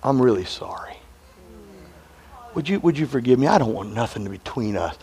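low, gentle apology, almost whispered; a wife making up with her husband in the car, tender and hushed. Retold or imitated. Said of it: "she sits down and said".

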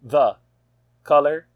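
one word at a time, with long gaps; staccato. Shown as a demonstration. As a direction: split the question into single words. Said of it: "just do the question like that, like, just one word at a time".

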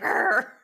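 mock pirate-like growl; angry growl. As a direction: growl it out, fired up. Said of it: "I'm just like".

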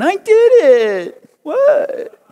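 put-on slow, very high childish whine; whiny kid. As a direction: drag it out like a sulking child. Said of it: "your kid's best whiny voice".

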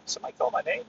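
near-whispered wondering aside; barely voiced question to oneself; hushed puzzlement. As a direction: ask it very softly, as if unsure who called. Acts out puzzled listener looking around.

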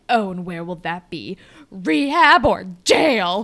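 slow, loud, hammed-up burn; a slow, loud, exaggerated soap-opera put-down; dramatic line reading. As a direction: land the rehab or jail line as a slow, loud zinger. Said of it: "It's so dramatic".

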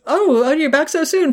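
a lightly played pleasant Peggy; voicing Peggy.

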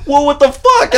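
high, loud retold protest; mock alarmed yell. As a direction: act out a startled, high yell. Morty shoved down, protests.

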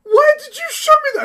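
high, loud tearful wail; mock crying girlfriend. Imitating girlfriend upset by the video, mock crying. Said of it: "I bet she cried".

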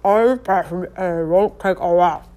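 muffled, stiff-mouthed garble; slurred, mushy words through a dirt-caked face; talking through caked mud. A narrator's reading. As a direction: talk with a mouth that will barely open. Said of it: "so caked with dirt that she couldn't even smile".

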